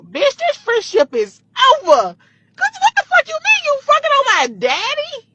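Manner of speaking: high, loud, outraged yell, slowed down; furious confrontation. Retold or imitated. Confronting her best friend at the gas pump, furious high shout. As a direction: hurl it at her, disgusted and betrayed.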